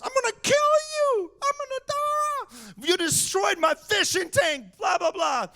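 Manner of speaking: very high, hammed-up hysterical yell; mock yelling. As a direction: imitate an overemotional cousin screaming threats. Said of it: "He yells".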